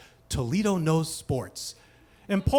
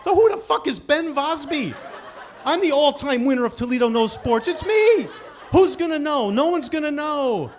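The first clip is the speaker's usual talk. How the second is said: high, loud, indignant protest; outraged; 9 semitones higher, 5 dB louder than usual. Retold or imitated. Trivia champion seeing a wrong name on screen, outraged high shouting. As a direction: wail it in frantic indignation, climbing to a shriek.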